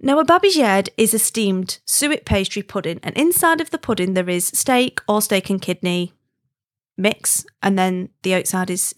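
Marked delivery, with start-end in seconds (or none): none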